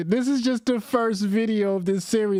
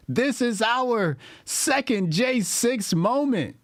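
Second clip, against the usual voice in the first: higher, slow mock-preacher call to arms; mock rally speech; 6 semitones higher than usual. Reactor parodying the streamer's revolution pitch, mocking.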